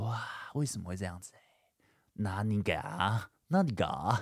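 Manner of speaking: whispered disbelief. Retold or imitated. Learning she cheated, inner thought.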